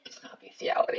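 hushed whispered denial. Pretend.

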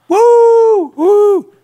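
very high loud whoop; concert cheer. Retold or imitated. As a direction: whoop like a fan at a show.

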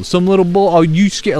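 put-on jeering at the scared boys; mocking taunt. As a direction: tease them for being scared. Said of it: "He goes".

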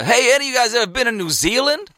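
high-pitched brash American drawing attention; loud American accent. Retold or imitated. American lad piping up to his mates at the bar, loud American accent. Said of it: "He's like your classic loud American".

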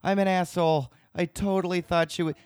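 high-pitched, mock-panicked self-blame; mortified self-reproach. Bartender to roommate on realizing the mistake, mortified. Retelling own embarrassed apology, very high.

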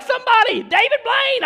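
very high mock-panic cry; freaking out. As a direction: squeal in exaggerated fright. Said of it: "Like, they freak out".

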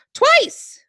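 high loud punchline; exclaiming. Acted. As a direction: blurt it out high.

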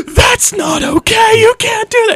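hammed-up outraged wife yelling; scolding wife. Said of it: "one of his wives is like".

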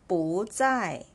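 "不在" with 不 rising, then 在 falling; second-tone 不. Shown as a demonstration. Teacher models the tone change, rising "不" in "不在". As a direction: lift "不" before dropping on "在". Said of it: "I read this word".

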